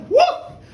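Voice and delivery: high, loud rising whoop; whoop of take-off. Acted. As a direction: whoop as the horse shoots off. Horse shoots off in race.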